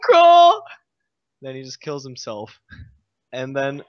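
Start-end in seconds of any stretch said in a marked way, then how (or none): 0.1-0.6 high, loud mock wail; mock sad cry. Retelling a character who's all sad, mock anguished cry. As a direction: cry out in exaggerated despair. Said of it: "he's all sad and he's like".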